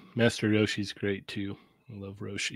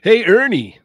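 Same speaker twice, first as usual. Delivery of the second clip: hyped-up, high, loud YouTuber greeting; big energetic channel intro voice; 10 semitones higher, 11 dB louder, slower than usual. Acted. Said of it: "the Lago YouTuber voice".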